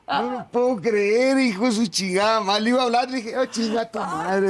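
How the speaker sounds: high, drawn-out disbelieving cursing; retold outrage. Man recounting seeing another man leave his house, retold outrage.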